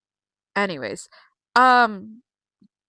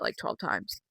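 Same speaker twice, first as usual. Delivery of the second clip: whispered aside; 12 semitones lower, 10 dB quieter, slower than usual. Real, not acted. Whispered emphasis on replays.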